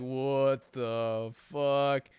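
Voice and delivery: drawn out in slow motion. Retold or imitated. Stunned driver watching his tire fly, in slow motion. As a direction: stretch it like a slow-motion replay. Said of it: "just in a slow-mo".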